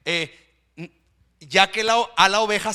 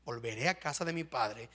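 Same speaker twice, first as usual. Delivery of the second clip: quiet echo; 8 semitones lower, 10 dB quieter than usual. Shown as a demonstration.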